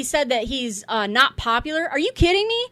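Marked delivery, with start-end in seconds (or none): 1.9-2.7 higher, rising disbelief; can't believe what he just read; incredulous. Real, not acted. Reacting to Trump's post about Chip Roy, incredulous and higher.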